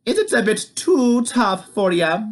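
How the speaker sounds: high mocking sweetness; teasing. A narrator's reading. Wife teasing the giant, high mocking voice. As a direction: needle the giant. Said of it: "said Oogna".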